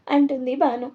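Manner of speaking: usual speaking voice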